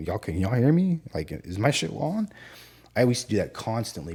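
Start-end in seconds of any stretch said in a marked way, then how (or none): 0.1-2.3 higher, rising questioning call; retold radio check. Officer checking a silent radio, questioning. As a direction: call out to check if anyone hears.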